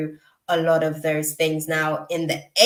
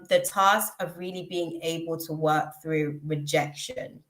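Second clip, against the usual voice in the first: ordinary talk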